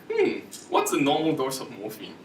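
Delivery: politely musing question; polite curiosity. Shown as a demonstration. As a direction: ask it lightly, as if just curious. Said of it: "say it in a very polite way".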